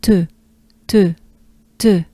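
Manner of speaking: "te" short with rounded French e; French e sound. Shown as a demonstration. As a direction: model clipped French "te". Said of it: "Take a good breath with me".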